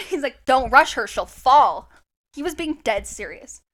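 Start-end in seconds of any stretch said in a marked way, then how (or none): 0.4-1.9 louder, firm repeat of the warning; mock stern boyfriend; insistent warning. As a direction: say it like he means it dead seriously.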